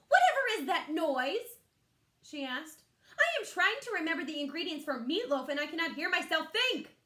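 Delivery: loud, high exasperated mother; mother loudly losing patience; irritated mother. Acted. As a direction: scold the noise, fed up.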